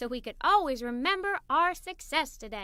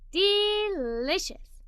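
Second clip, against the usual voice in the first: slow, drawn-out relish; savoring; 5 semitones higher, much slower than usual. Acted. Girl relishing her giant chocolate cone, slow and savoring. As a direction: linger on it like the first taste.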